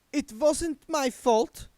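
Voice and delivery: high, slow, prim and stiff. A narrator's reading. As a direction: defend herself with stiff dignity. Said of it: "said Doreen stiffly".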